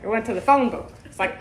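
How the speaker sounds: normal talking voice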